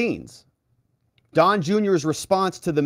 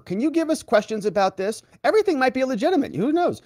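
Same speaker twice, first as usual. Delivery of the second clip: higher, fast sarcastic parody of the email; sneering mock reporter; 11 semitones higher than usual. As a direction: rattle it off like a smug reporter. Mocking the Forbes reporter's email.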